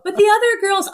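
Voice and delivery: high, loud mock protest; retold protest. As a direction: protest to the coach, hammed-up. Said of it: "And I'm like".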